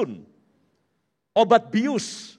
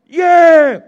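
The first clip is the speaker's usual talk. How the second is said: high, loud whoop of release; sudden shout of relief; 6 semitones higher, 11 dB louder than usual. Retold or imitated. Architect friend in the boarding house finishing his task, loud whoop. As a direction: burst out like you just finished the hardest task. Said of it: "tiba-tiba berteriak".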